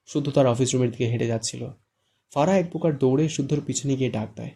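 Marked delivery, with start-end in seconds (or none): none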